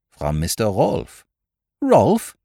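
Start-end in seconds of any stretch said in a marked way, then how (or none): 1.8-2.4 astonished echo. Acted.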